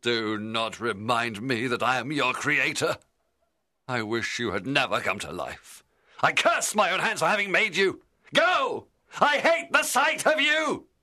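bitter rebuke erupting into yelling; furious creator. A narrator's reading. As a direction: rage building to a high, loud shout. Creator curses and banishes monster.

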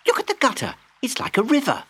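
put-on high excited child. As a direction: point it out like an eager kid. Child voiced in story.

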